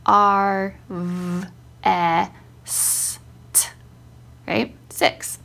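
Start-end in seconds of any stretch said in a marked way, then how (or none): 0.1-3.7 R, V, E, S, T as drawn-out phonemes with pauses; separate sounds. Shown as a demonstration. Counting a word's sounds for her child, one by one. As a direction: sound out each of R, V, E, S, T alone, S and T apart. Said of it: "count out the sounds that he hears".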